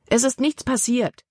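calling out. A narrator's reading. Libby calls out to Caleb after nearly being hit. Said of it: "rief Libby aus".

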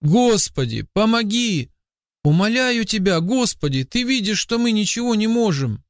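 high-pitched pleading, narrated at moderate volume; desperate cry. A narrator's reading. Boy begs God for help on icy river, desperate cry. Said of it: "закричал".

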